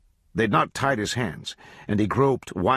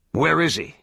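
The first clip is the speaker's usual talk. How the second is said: higher, pressing question; hard question; 7 semitones higher, 4 dB louder than usual. A narrator's reading. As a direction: demand it coldly. Interrogating the hanged man.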